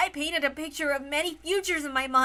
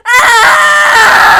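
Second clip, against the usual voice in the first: a loud, high wail of grief; wailing sob; 10 semitones higher, 18 dB louder than usual. Acted. Battler wails aloud as the cousins weep together. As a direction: let it all out in a howl.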